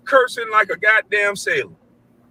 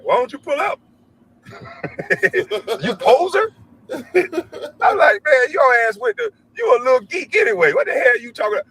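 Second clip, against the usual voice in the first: mocking taunt; 6 semitones higher than usual. Dad mocking son's online beef.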